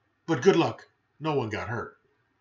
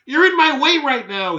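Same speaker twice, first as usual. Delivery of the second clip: much higher and louder, indignant; cross dog voice; 10 semitones higher, 10 dB louder than usual. Acted. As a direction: scold like an annoyed pup. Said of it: "he said".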